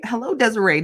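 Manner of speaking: usual speaking voice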